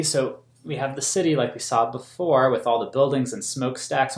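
ordinary delivery, nothing marked

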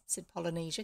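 usual speaking voice

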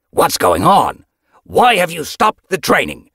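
loud challenge, climbing high; angry demand. A narrator's reading. Leader confronting Yellowfang over halted training, angry demand. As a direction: demand an explanation, rising in pitch.

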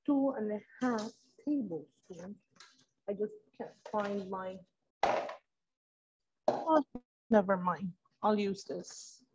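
low, soft self-talk while searching; talking to oneself. Real, not acted. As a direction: think aloud quietly, half to yourself.

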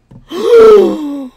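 high loud hammed-up gasp; dramatic gasp. [name] at the reveal, loud mock gasp. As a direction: gasp like a soap star.

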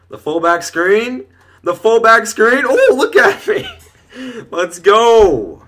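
slow, loud chant climbing into a high whoop; building elation. Real, not acted. Gamer watching his fullback screen succeed, building elation. Screen play breaks open.

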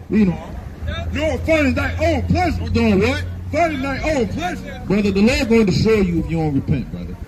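high, forceful preacher's rebuke; fired-up street sermon; street preaching. Acted. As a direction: preach it hard at him, full force.

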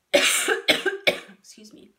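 coughing fit, then a strained excuse me. Real, not acted. Narrator coughs, apologizes.

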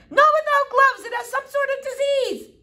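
very high, loud shriek; shrill mother crying out in horror; panicked shriek. Acted. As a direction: shriek a frantic warning. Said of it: "shrieked Flora's mother".

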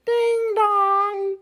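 high sung ding-dong chime; doorbell ding dong. Acted. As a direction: sing it like a doorbell. Doorbell sound imitated.

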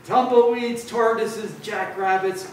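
high-pitched exasperation, easing down; exasperated listing. A narrator's reading. Grumpy cactus fed up with visitors, exasperated.

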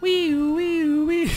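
high, gleeful squealing wee. Real, not acted. Gamer squealing with glee as the tricycle speeds off.

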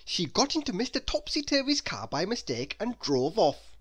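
usual speaking voice

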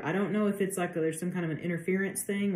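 normal talking voice

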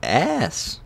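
real squeal of disgust; disgusted outburst. Real, not acted. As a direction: spit out the complaint, still reeling. Still reeling from the bad taste.

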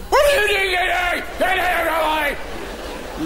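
sudden high-pitched parade-ground yell; sergeant major shout. Retold or imitated. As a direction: imitate a sergeant screaming at a recruit. Acted sergeant major yelling at soldier.

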